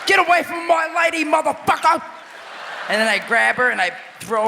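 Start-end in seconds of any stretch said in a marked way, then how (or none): 0.1-2.0 high, shouted fake Scottish accent; hammed-up Scottish villain shout; bad Scottish accent. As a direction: yell it in a clumsy Scottish brogue. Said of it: "the Scottish people speak?".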